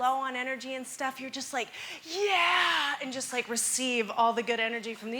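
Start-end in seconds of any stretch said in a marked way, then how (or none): 2.0-2.9 long, high, loud "yeah"; power-pose cheer. Shown as a demonstration. Showing the panel her power pose, triumphant cheer. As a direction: throw out an energizing yell.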